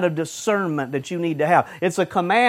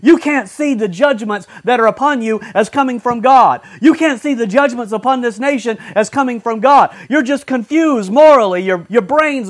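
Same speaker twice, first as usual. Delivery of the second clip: loud, driving preacher's rebuke; fiery sermon delivery; full-force preaching; 6 semitones higher, 6 dB louder than usual. Acted. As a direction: hammer it home from the pulpit.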